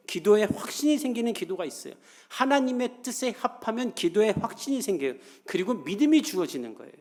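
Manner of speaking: fervent preaching. Acted. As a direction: build it up for the congregation. Preacher builds to a climax.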